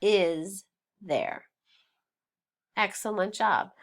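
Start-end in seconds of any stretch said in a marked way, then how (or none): 0.0-1.5 very slow, each word separate; spelled-out contraction. Shown as a demonstration. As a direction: pause between every word to show the full form. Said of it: "it says".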